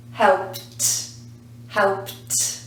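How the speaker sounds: "helped" as "helpt", strongly released "t"; one syllable on "helped", crisp detached "t"; final T sound. Shown as a demonstration. Teacher giving the past tense answer, "helped" as "helpt". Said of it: "the sound that we add on is t".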